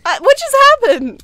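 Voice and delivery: loud, high, fast disbelief; startled shout. Real, not acted. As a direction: shout it in sudden confusion. Sudden shock in ghost hunt.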